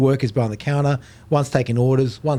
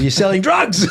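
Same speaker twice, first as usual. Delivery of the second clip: suspicious dad; 6 semitones higher, 4 dB louder than usual. Retold or imitated. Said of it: "he goes".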